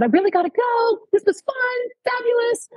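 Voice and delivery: high, sing-song fake politeness; mock polite enthusiasm. Reporter trying to leave the healer, mock enthusiastic. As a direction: pitch it way up, politely escaping. Making excuses to escape the healer.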